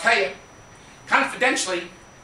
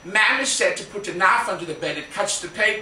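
Prissy impression; 7 semitones lower, 4 dB quieter, faster than usual. Rushed panicked Prissy line.

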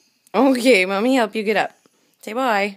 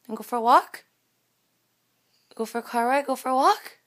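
excited dog coaxing; 7 dB louder than usual. Real, not acted. Owner coaxing dog to walk.

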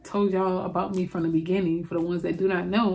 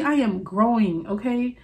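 ordinary talk

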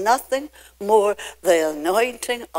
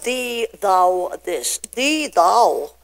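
mock old-fashioned speech; much slower than usual. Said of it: "It's not".

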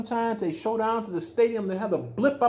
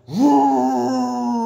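loud excited higher woo; 5 semitones higher, 5 dB louder than usual. Pretend. Telling a child about race cars, a loud whoop. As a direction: whoop like a speeding race car. Race car goes fast.

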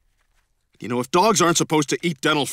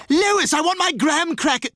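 baby voice imitation; 13 semitones higher than usual.

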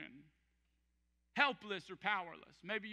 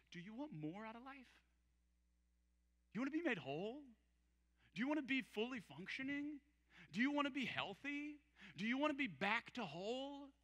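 gentle asking; 3 semitones higher, 6 dB quieter than usual. Acted. Jesus walks up to the lame man, gentle asking. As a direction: ask softly, leaving space after each question.

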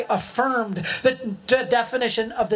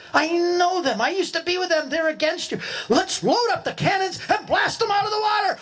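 high, rising call to blast them; hostile urging; 9 semitones higher than usual. Pretend. Speaker pretending to urge captain to fire cannons, heated. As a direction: whip up the captain to attack.

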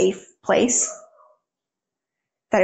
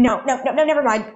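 higher, hurried imitation of a scared kid; anxious pleading; 3 semitones higher, faster than usual. Little brother on the phone begging, rising anxious plea. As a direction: plead in a rising, worried rush.

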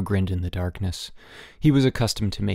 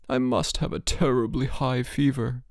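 put-on pleading sick boy; 3 semitones higher than usual. A narrator's reading. Sick boy begging his roommate for a cold cloth, pleading. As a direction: beg weakly from the pillow.

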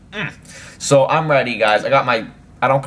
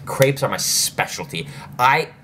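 ordinary delivery

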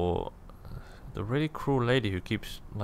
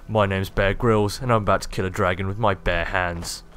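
ordinary delivery

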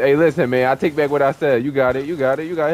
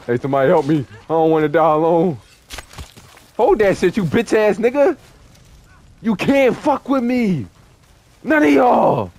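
higher, louder battle yells; heated shouting; 6 semitones higher, 6 dB louder than usual. Real, not acted. Gamer shouts taunts while fighting alone. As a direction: yell it out in the thick of the fight.

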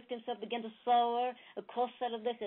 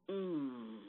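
groan; 6 semitones lower, 5 dB quieter than usual. A narrator's reading. Dying buffalo groans.

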